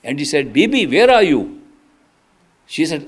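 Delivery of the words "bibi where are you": a raised call through the door. Retold or imitated. Master calling to Bibi behind the door, loud and urgent.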